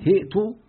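هئت with ha under kasra and a glottal stop held silent before ta; corrected recitation. Shown as a demonstration. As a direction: say هئت as hi'tu, a clear hamza after the ha. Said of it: "بكسر الهاء وسكون الهاء".

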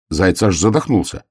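usual speaking voice

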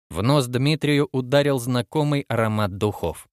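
usual speaking voice

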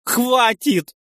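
high, loud scream to stop; shrieked, desperate command; sudden cry. A narrator's reading. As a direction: burst out at the top of the voice. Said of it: "Внезапно вскрикнула жена".

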